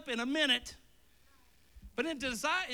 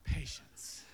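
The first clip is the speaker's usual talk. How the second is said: whispered; 9 semitones lower, 5 dB quieter than usual. Acted. Whispered after child bit.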